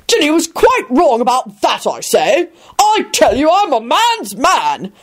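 high-pitched, pompous protest; blustering. A narrator's reading. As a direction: insist with drawn-out final words. Richard bellows comic protest.

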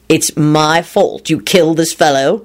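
slow, incredulous echo; disbelieving. A narrator's reading. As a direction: repeat the charge slowly in disbelief. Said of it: "Lupin said disbelievingly".

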